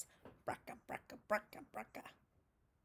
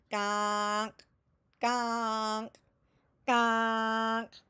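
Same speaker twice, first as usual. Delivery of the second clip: slow, drawn-out honking gonk; big duck honk; 3 dB louder, much slower than usual. A narrator's reading. Big ducks hanging back, slow drawn duck honks.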